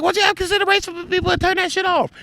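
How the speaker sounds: fast, very high mock complaint; indignant falsetto rant; mimicked irate friend. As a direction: rattle it off in falsetto, imitating her. Acts friend's quarrel over light.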